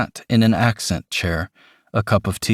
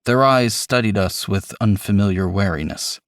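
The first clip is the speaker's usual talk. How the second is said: ordinary delivery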